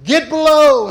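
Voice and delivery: shouted high and loud; frantic father yelling over the wind. Retold or imitated. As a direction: bark the order over the storm. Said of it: "my father yelling at all of us".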